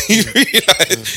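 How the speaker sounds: laughing, high and drawn out. Real, not acted. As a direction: laugh through the question. Laughing emphatic question.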